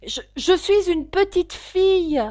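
slow, stammering, unsure; hesitant. Acted. Alice hesitantly telling the pigeon what she is. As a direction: stumble over the words. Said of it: "avec quelque hésitation".

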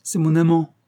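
annonce forte, plus aiguë; avec éclat. A narrator's reading. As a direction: proclamer pour choquer. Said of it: "fit Rose avec l'éclat". (aveu lancé avec éclat)

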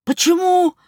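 высокий детский голосок; спросить очень высоко, по-мальчишески; удивлённый мальчик. A narrator's reading. Мальчик спрашивает продавца. (по-детски удивлённо)

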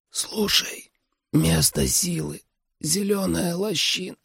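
high, soft, laboured voice; dying man's message. A narrator's reading. Dying man telling of a place of power, weak and urgent. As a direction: push out words with failing breath.

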